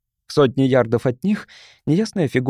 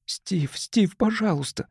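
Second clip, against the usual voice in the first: frightened plea; 6 semitones higher, 5 dB quieter, slower than usual. Acted. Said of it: "с испугом схватила его".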